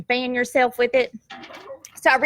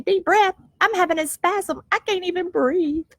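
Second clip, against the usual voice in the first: incredulous inner voice; 8 semitones higher than usual. Retold or imitated. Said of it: "And I'm thinking".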